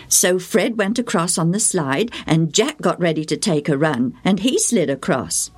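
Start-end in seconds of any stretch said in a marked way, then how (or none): none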